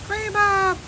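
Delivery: high-pitched call of a name; calling a pet. Real, not acted. Vlogger spotting Gray Bob calls out to the animal, high pet call. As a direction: greet a little animal in a high voice.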